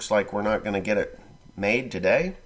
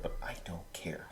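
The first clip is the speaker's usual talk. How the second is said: whisper; 2 semitones lower, 13 dB quieter than usual. Real, not acted. Conspiratorial aside to camera.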